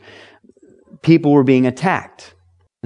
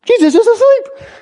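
mock alarm; 6 dB louder than usual. Disciples alarmed, Jesus asleep.

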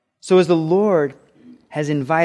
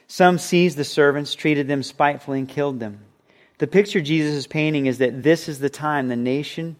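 usual speaking voice

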